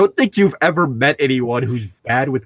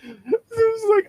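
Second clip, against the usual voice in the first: high, slow, through laughter; can barely speak for laughing; slower than usual. Real, not acted. Friend retelling the misread, high and laughing.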